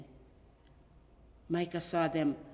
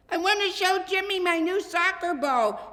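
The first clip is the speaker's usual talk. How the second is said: high, louder excited call; shouted over shoulder; 13 semitones higher, 10 dB louder than usual. A narrator's reading. Boy racing to his friend's house, shouting back. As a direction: fling it back over your shoulder while running. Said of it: "he shouted over his shoulder".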